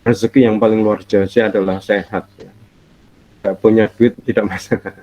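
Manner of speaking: usual speaking voice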